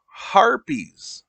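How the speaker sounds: high, loud, drawn-out "harpies"; dawning realization. Acted. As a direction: finally get it, loudly. Host finally understands the word.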